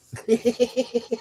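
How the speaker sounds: short high chuckle; high laugh. Real, not acted. Laughing at his own joke, short and high.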